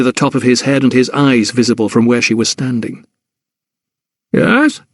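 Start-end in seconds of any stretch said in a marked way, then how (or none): 4.3-4.8 haughty porter. Acted. Bewigged porter looks down.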